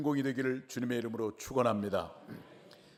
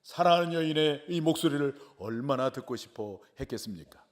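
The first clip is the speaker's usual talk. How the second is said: ordinary delivery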